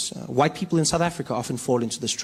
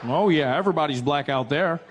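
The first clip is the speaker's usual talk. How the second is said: put-on American accent; 3 dB quieter than usual. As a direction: assure him in an American accent. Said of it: "I met an American".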